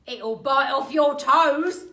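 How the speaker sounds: put-on loud, slow menacing old man; Mr Twit voice. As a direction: scare her slowly. Mister Twit scaring wife.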